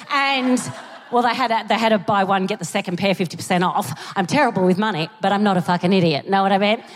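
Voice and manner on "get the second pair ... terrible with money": ordinary talk, nothing marked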